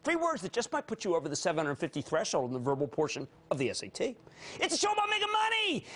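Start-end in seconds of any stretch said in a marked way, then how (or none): 4.6-5.9 high-pitched rallying cry; excited holler. Real, not acted. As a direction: belt out the slogan, high and hard. Host hollers show's slogan.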